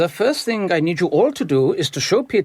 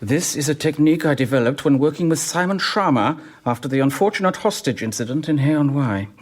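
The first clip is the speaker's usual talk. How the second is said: ordinary talk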